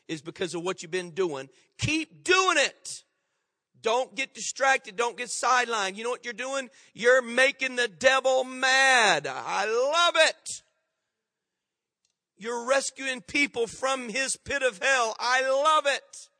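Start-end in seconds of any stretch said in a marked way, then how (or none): 1.8-2.9 rousing exhortation. Real, not acted. Preacher urging the congregation on. 6.9-10.5 slow, drawn-out preacher's shout; rally the congregation, savouring every word; exultant preaching. Real, not acted. 15.2-16.3 slow, high, relishing cry; exultant preaching. Real, not acted.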